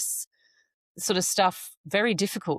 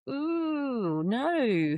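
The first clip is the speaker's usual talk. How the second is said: recoiling second thought; 6 semitones higher than usual. Retold or imitated. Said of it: "Then I just went".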